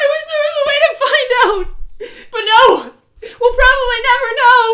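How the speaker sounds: sob-laughing as it all spills out; crying rant. Acted. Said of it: "as I cried".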